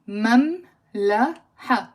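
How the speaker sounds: مملحة broken into "mam... la... ha"; slow, separated "mam-la-ha" for مملحة, both Ms sounded; syllable-by-syllable demonstration. Shown as a demonstration. Teacher showing the two Ms, مملحة slowly syllable by syllable. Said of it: "There are two M".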